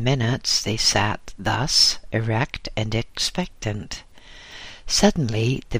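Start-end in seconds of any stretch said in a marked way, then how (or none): none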